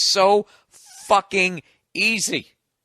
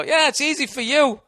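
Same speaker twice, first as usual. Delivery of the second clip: mocking other comics; 7 semitones higher, 3 dB louder than usual. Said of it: "they just go".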